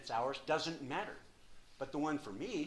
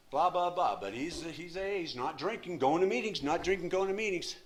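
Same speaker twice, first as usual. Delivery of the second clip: mocking rant; 5 semitones higher, 9 dB louder than usual. Real, not acted. Ranting about his brother's routine.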